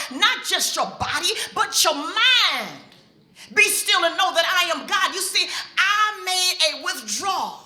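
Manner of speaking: preaching God's message to the audience. Acted. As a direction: proclaim it with conviction.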